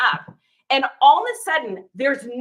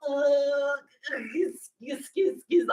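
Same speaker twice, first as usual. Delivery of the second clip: awkward stammer; 4 dB quieter, slower than usual. Retold or imitated. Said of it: "my social anxiety, awkwardness".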